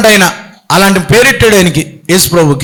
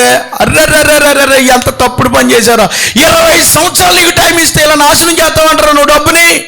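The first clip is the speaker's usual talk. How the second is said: raised, ringing rebuke from the pulpit; rebuking shout; 4 semitones higher than usual. Real, not acted. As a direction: shout the scolding at the lazy servant. Preacher rebukes the lazy servant.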